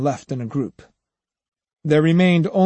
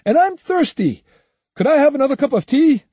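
high, quick peevish request; complaining; 13 semitones higher, 5 dB louder than usual. A narrator's reading. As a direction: whine for tea, fast and high. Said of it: "complained Kojiro".

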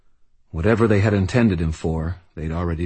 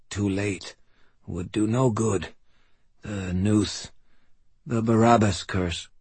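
put-on weak old man; 4 semitones higher than usual. Dying old man speaks.

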